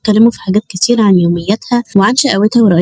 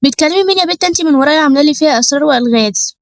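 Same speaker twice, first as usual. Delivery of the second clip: high-pitched, nagging mother's interrogation; irritated mother; 7 semitones higher than usual. A narrator's reading. Mother confronts her daughter about secret phone calls, irritated. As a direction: scold as a suspicious mother, pitched up. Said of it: "والأم خرجت بعصبية".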